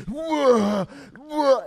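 two loud, high retching bellows; imitated loud heaving. Man reenacting his vomiting for a friend, imitated loud heaves. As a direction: mimic noisy heaves, one after the other. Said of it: "it was loud as fuck".

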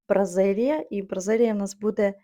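normal talking voice

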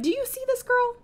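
high-pitched gushing amazement. Real, not acted. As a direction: squeal it in admiration, voice way up high. Gushing over her Sim character.

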